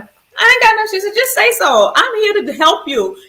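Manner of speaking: mother at bedside, voiced high and loud; mom's voice. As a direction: voice mom gently insisting. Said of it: "she was like".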